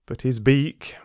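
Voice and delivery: ordinary delivery, nothing marked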